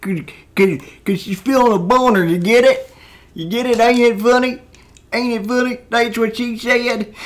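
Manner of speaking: high, loud hammed-up Southern accent, cackling. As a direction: crow the joke in a rowdy Southern twang. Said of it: "doing the southern accent".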